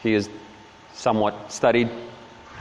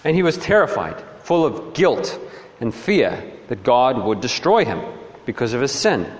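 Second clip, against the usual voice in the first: normal talking voice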